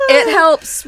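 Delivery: high, loud laughing tease. Real, not acted. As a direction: tease her while cracking up. Laughing while teasing.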